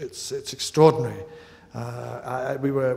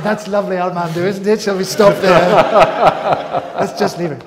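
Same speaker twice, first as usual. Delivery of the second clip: laughing retort; 6 semitones higher, 10 dB louder than usual. Retold or imitated. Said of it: "I said".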